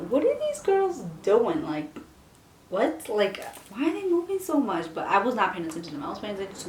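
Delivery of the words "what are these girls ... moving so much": high, baffled questioning; puzzled. Retold or imitated. At lunch watching her friends, high puzzled wondering. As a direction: squint at the friends, confused and higher. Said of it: "and I'm like".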